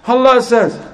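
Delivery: high, insistent comeback; acted emphatic retort. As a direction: play the father firing back his authority. Father retorts in retold quarrel.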